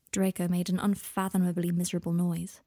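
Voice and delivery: ordinary delivery, nothing marked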